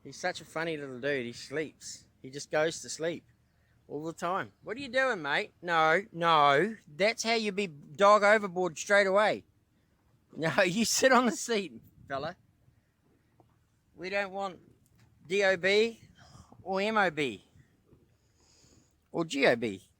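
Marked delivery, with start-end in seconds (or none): 4.6-5.5 pet talk. Retold or imitated. Voicing his question to dog. 5.6-19.9 loud, high, mock-stern telling off; playful dog scolding. Real, not acted. Owner playfully scolds his dog to stay on the seat, loud and high.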